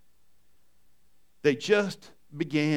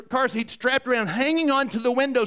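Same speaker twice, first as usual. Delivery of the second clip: ordinary delivery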